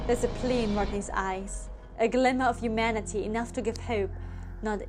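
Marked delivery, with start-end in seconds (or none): none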